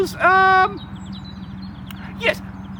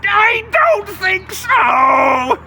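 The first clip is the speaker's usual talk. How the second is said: slow, loud, higher menacing troll cry; troll shout; 5 semitones higher, 5 dB louder, much slower than usual. Acted. Troll blocking the goat on the bridge, slow loud shout. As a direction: draw it out as a threat. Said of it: "the troll said".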